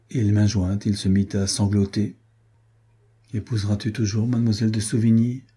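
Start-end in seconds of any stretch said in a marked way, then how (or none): none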